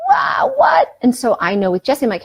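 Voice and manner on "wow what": high, loud mock wail of protest; hammed-up kid's outburst, pitched way up; upset kid. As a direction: imitate a child freaking out at bedtime. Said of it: "kind of kid that'll be like".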